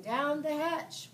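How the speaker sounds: slow cheering reply; group shout. A narrator's reading. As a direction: shout it back as the crew. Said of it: "we all shouted".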